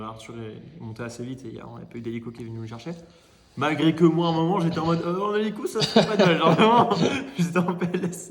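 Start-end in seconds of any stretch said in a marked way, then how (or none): 3.6-8.3 excited laughing. Real, not acted. Recalls wanting a helicopter rescue.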